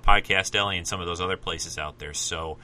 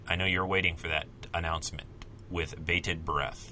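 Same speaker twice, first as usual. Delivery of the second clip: wry aside; 6 semitones lower, 8 dB quieter than usual. Real, not acted. Self-mocking aside.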